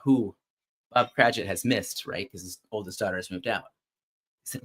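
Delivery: usual speaking voice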